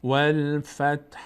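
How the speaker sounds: والفتح drawn out slowly, final t unvoweled; slow Quranic chant of والفتح; slow recitation demo. Shown as a demonstration. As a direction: stretch والفتح slowly and stop on bare t. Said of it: "ت را هم حرکت ندیم".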